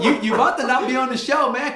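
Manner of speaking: high, loud and laughing; laughing mock warning. Real, not acted. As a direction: tease a threat through laughter. Laughing mock threat to clowning friend.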